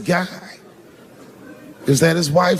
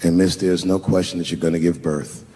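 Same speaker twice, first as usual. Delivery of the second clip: mock-polite apology; 9 semitones lower than usual. Acted polite apology before the jab.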